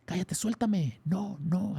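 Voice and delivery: hammed-up demon shouting to be released; demon screaming. Retelling the possessed woman shouting at the helpers, mock demon yell. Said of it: "nos gritaba mucho que".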